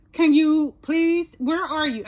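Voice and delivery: retold impatient demand; frustrated attitude. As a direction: stretch out an exasperated request. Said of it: "like, totally frustrated".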